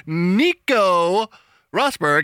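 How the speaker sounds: "Nico" drawn out high and rising, "Rosberg" rolled carefully; mock over-careful announcer; overpronounced name. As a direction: ham up an announcer savoring every syllable of "Nico Rosberg". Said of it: "he, like, really overpronounces everything".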